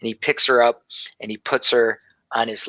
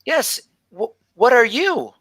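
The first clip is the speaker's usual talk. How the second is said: lightly voiced young girl, slightly higher; a hint of a shy seven-year-old telling her dream; little girl Gelby; 7 semitones higher than usual. Gelby answers Nigel.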